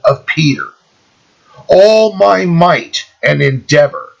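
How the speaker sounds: solemn oath recital with pauses after short phrases; slow, deliberate reading. Shown as a demonstration. Reader lets listeners take in the cardinal's oath, slow and deliberate. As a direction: read it slowly, stressing each key word. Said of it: "I'm gonna read this really slow".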